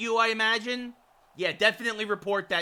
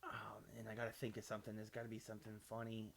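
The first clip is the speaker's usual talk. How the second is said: barely audible low murmur; musing to himself; 9 semitones lower, 22 dB quieter, faster than usual. Real, not acted. Streamer searching for something funny to pick, very quiet musing. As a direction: think aloud under your breath. Thinking aloud for an answer.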